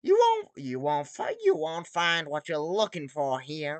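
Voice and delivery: squeaky sponge voice warning the pirate off; SpongeBob warning. Acted. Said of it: "SpongeBob warns".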